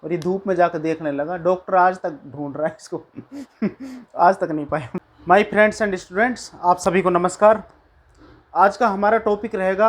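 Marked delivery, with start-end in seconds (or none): 3.1-4.1 a quick amused laugh at his own punchline; short laugh. Real, not acted. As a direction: laugh it off lightly.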